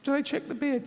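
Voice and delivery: high, suspicious mock question; fearful inner voice. Fearful inner voice doubting the security checks, high. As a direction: ask it high and worried. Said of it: "Why do you think that".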